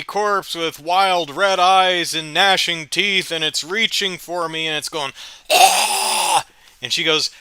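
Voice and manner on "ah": breathy zombie moan. Acted. Zombie reaching for her.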